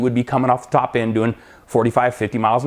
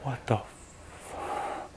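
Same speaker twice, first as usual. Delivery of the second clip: whispered, drawn-out cut-off curse; stunned under-the-breath reaction; muttered disbelief; 17 dB quieter, much slower than usual. Retold or imitated. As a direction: breathe it out very softly and slowly, breaking off. Thinking it, holding in disbelief.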